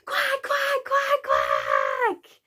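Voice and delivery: very high, loud squawking quacks; shouted duck quacks. Acted. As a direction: quack frantically at the top of your voice. Climax of sung duck rhyme.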